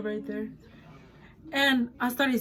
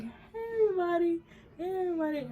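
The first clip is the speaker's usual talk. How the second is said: higher, sing-song tipsy hello; mock drunk greeting; 7 semitones higher, 5 dB quieter than usual. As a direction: greet the room like a cheerful drunk. Retold drunk greeting at party.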